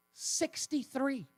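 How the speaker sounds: Sixty-three drawn out slow and high; emphatic repeat. Real, not acted. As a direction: stretch Sixty-three slowly. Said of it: "emphatic repeat of figure".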